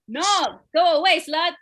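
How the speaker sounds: loud, mock-angry brush-off; irritated dad. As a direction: send the kid away sharply. Dad snaps at interrupting child.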